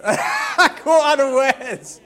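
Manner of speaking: laughing loud through the words; loud laughter. Real, not acted. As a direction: burst out laughing.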